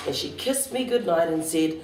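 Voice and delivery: ordinary delivery, nothing marked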